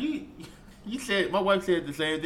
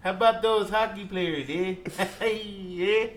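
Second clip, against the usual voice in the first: higher hammed-up Canadian, chuckling; badly faked Canadian accent; 5 semitones higher, 4 dB louder, slower than usual. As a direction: ham up the Canadian small talk, laughing. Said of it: "I work on my accent".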